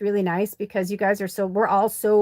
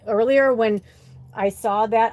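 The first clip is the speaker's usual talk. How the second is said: ordinary talk, nothing marked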